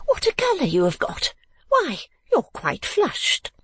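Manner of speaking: high-pitched exclaiming mother; sudden exclamation. A narrator's reading. Mother noticing her daughter's colour, high exclamation. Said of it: "cried Missus Nickleby with her wonted suddenness".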